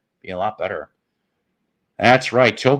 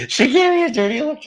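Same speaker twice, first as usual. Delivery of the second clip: much higher, faster, cutesy voice; squeaky pet talk; 4 dB louder, faster than usual. Acted. Streamer tattles about the cat in a squeaky pet voice. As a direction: coo it up high like talking to a pet.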